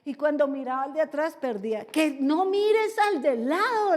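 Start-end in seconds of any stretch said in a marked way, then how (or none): 1.8-4.0 higher, louder, drawn-out reprimand; imitated coach's yell. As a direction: shout it like a coach to a swimmer mid-race. Instructor yelling at a swimmer. (regaño a gritos de entrenador)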